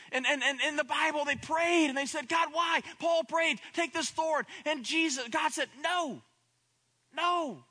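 high, loud, building sermon cry; preaching at full force. Acted. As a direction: preach it, climbing and crying out.

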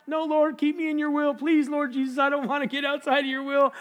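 high, anxious plea; beg, pitched well up; urgent pleading. Acted. Preacher begging the Lord to keep him, high and urgent.

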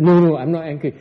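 loud, hasty mock denial. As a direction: brush the anger off too firmly. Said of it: "neutralizes the thought of anger and says".

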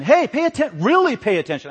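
high, loud, urgent call for attention; raising the alarm. Acted. Preacher voices Moses raising the alarm, loud and urgent. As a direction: sound the alarm, voice jumping up. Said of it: "He's raising the alarm".

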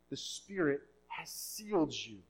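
usual speaking voice

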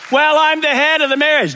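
high, loud, smug husband boasting; arrogant husband. Retold or imitated. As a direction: puff up like a bossy husband. Abusive husband quoted.

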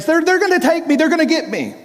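high, loud panicked cry that fades at the end; terrified cry. Retold or imitated. Dying deacon fearing he'll be taken, panicked high cry. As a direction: cry out as if dragged away. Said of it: "his eyes are bulging out".

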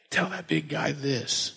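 voice of God. Pretend. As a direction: hand down the order slowly and hushed.

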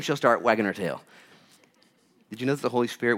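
usual speaking voice

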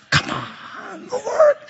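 high, strained mock whine to God; exasperated protest. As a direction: plead in hammed-up frustration, pitch way up. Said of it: "I can be frustrated".

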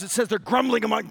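usual speaking voice

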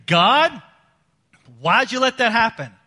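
high, loud protest to God; whiny complaint. Retold or imitated. A sinner blaming God for consequences, loud and whiny. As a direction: complain like a wronged child. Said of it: "don't say".

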